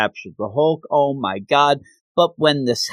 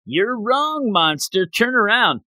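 lightly voiced villain, pitched up high; gargoyle; 8 semitones higher than usual. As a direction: imitate a monster's challenge from the shadows. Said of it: "comes the horrific gargoyle, says".

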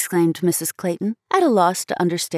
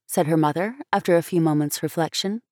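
plain reading voice

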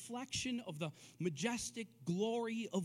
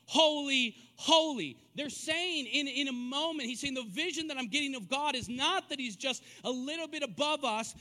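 loud, higher-pitched sermon delivery; impassioned preaching; 5 semitones higher, 7 dB louder than usual. Real, not acted. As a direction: drive home each key word with force. Preaches God's otherness at full force.

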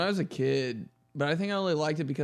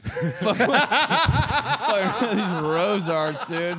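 loud, high, helpless laughter through the words; laughing hard; 7 semitones higher, 7 dB louder, much slower than usual. Real, not acted. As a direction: crack up while dragging out the words. Cracking up over cheap crayons.

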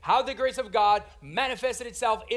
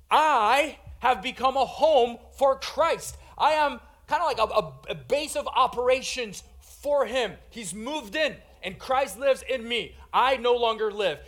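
loud, high, emphatic declaration; raised preacher's voice, punching key words; bold testimony; 5 semitones higher, 6 dB louder than usual. Acted. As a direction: proclaim it as Paul, with force. Preacher acts out Paul's bold testimony.

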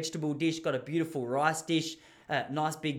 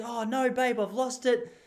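higher, mock-worried wife voice; wife hamming up a lost certificate, pitched higher; wife pretending; 8 semitones higher, slower than usual. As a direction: fake the panic of losing the phone. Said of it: "She goes".

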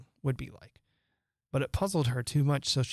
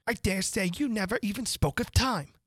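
higher, lively mad Hatter; excitable Hatter; 6 semitones higher than usual. A narrator's reading. Hatter boasting to Alice what time can do, excitable.

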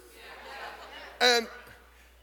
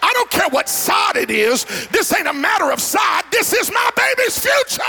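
higher and louder, rising to a shout; bold declaration; 10 semitones higher, 5 dB louder than usual. Acted. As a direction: proclaim it as if defending your own child. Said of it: "Christians should have the courage to say".

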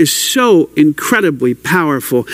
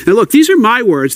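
ordinary talk, nothing marked

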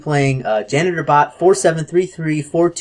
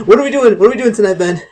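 announcer voice; 5 semitones higher, 4 dB louder, faster than usual. Acted. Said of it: "repeats line in announcer voice".

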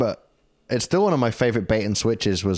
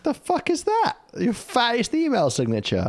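high-pitched mock disbelief; incredulous echo; 13 semitones higher than usual. Co-host queries the odd sign-off wording, high and incredulous. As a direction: quote it back much higher, baffled by the wording.

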